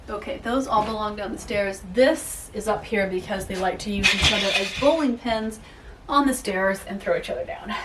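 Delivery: ordinary talk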